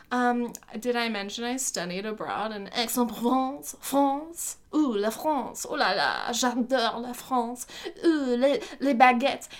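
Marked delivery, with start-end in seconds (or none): 2.7-9.5 hammed-up French accent; mock-French gushing, playfully overdone; super French accent. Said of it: "in a super French accent".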